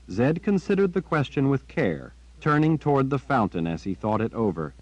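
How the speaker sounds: usual speaking voice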